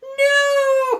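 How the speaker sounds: high, very loud scream of no; terrified cry. Acted. Witch fleeing the dragon, terrified scream. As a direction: scream in fright. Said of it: "cried the witch".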